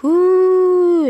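high, loud spooky ghost hoot; a ghost hooting in the dark; ghost whoo. Acted. Something unseen hoots at the boys, high spooky whoo. Ghost noise in scary story.

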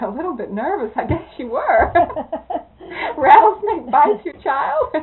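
amused disbelief; incredulous, laughing. Real, not acted. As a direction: laugh at the understatement. Teller's incredulous retort.

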